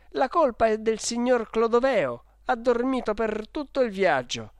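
put-on high, eager boyish blaming; delighted. Boy blaming the sleeping guardian before the clerk, delighted. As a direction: pipe up happily to tell on him. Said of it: "tutto contento".

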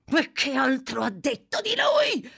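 high-pitched pressing cry; shouted demand. A narrator's reading.

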